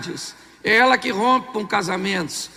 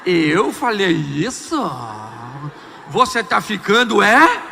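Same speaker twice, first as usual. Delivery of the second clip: cynical husband; slower than usual. Retold or imitated. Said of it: "Ele olha pra você e diz".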